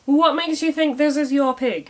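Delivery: outraged your pig; indignant outburst. Acted. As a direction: scoff at the claim in outrage. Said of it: "says Kit".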